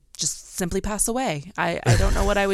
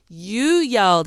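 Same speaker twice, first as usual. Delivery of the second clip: louder, higher stress on "you"; insistent accusation; 6 semitones higher, 6 dB louder, slower than usual. Retold or imitated. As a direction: throw the blame back, hitting "you" hard. Husband's retold counter-accusation.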